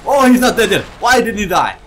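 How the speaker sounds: high shrieking alarm; shrieking panic. Real, not acted. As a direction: scream it in shock. Enemy not dead, shrieks.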